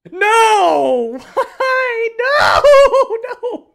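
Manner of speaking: loud, high, half-laughing cry of despair; anguished shout. Real, not acted. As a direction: howl at the bad luck while laughing. Loses the flag again.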